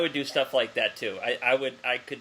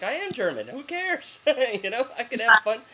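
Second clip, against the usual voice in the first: mock flippant; 7 semitones higher than usual. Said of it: "I'm like".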